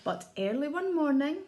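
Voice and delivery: usual speaking voice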